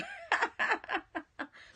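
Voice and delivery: high laugh falling off; real high peal of laughter; amused laugh. Real, not acted. Laughs at repeated phrase.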